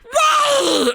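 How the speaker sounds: very high, loud shout of surprise; startled why. A narrator's reading. Boy shocked by the offer of a bedroom. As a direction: blurt it out in disbelief.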